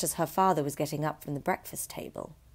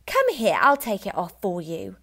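brisk, higher and louder wife; bossy wife taking charge; wife's voice; 3 semitones higher, 6 dB louder than usual. A narrator's reading. Wife to husband, impatient and higher. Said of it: "She gave the hat a sharp yank".

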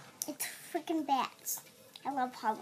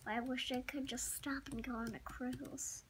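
soft wistful whisper; 4 semitones lower, 15 dB quieter than usual. Acted. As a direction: breathe it out to yourself. Whispered wish to self.

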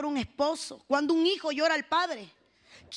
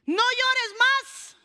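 very slow, high command, rising at the end; ringing proclamation; 7 semitones higher, 5 dB louder, much slower than usual. Acted. Preacher repeats Jesus's answer to the congregation, ringing proclamation. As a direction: stretch it out and lift it high.

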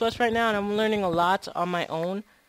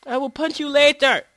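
loud, high exasperated yell; mock angry scolding; 7 semitones higher, 9 dB louder than usual. Player telling off Wolfie mid-game, mock angry. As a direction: scold loudly in play anger.

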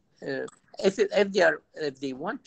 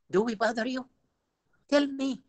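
higher, confrontational mock challenge; loud challenge; 9 semitones higher than usual. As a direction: throw the question at him like a retold provocation. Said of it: "in a loud voice".

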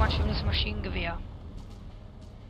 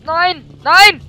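loud high anguished shout; 9 semitones higher, 17 dB louder than usual. Real, not acted. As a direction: scream it out in dismay. Gamer dies, shouts.

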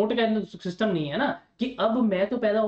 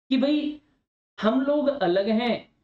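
ordinary talk

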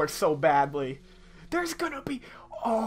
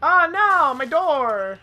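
higher, louder startled cry; real alarm; 10 semitones higher, 9 dB louder, slower than usual. Real, not acted. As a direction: yelp as the door goes. Door breaks during play.

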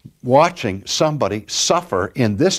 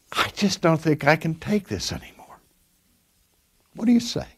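higher, softly worn out, rising at the end; weary plea; 5 semitones higher, 3 dB quieter than usual. Retold or imitated. Dying woman asking her pastor for an answer, weary and soft. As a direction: ask it as someone at the end of her strength.

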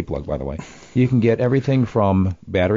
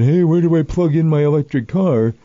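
lightly acted, higher-pitched question; mock naive newcomer; 6 semitones higher, 3 dB louder than usual. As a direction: imitate someone asking cluelessly, voice lifted. Voices clueless newcomers asking.

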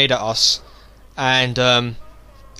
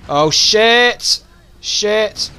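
startled shout; 6 semitones higher, 5 dB louder, much slower than usual. Real, not acted. Sudden danger in game.

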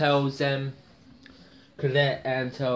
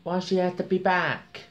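whiny Bobby voice; 7 semitones higher than usual. Retold or imitated. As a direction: whine like an upset teen. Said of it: "he said".

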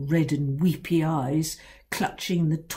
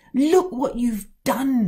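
a fuming father, louder and slow; 7 semitones higher, 5 dB louder than usual. A narrator's reading. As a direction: spit it out in a fuming rage. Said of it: "he fumed".

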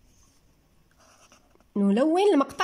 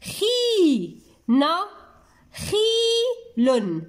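long drawn-out syllables with gaps; 6 semitones higher, much slower than usual. Shown as a demonstration. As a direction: break the word into separate stretched syllables. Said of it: "ثلاثة المقاطع".

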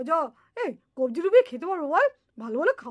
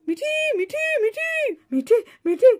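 calling out; 6 semitones higher than usual. Acted. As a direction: shout the name to find her. Boy calling out to friend.

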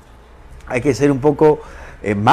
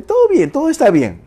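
chirpy husband; 4 dB louder than usual. Retold or imitated. Husband's reply voiced.

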